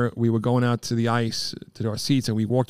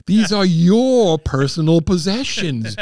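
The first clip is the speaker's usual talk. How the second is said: slow put-on movie voice, a touch higher; Trading Places quote; 5 semitones higher, 5 dB louder, slower than usual. Friend recalling a movie scene, slow put-on voice. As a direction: drawl it out like the film's stiff official. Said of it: "It was like that scene from, uh, Trading Places".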